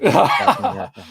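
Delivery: high, loud laughing exclamation. Real, not acted. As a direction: burst out laughing on the name. Laughs saying the name.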